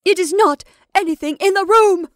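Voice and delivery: high, loud, wailing denial; obstinate horror. Acted. Rebecca contradicting her sisters, obstinate horror. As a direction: refuse comfort, terrified. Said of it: "repeated Rebecca with obstinate horror".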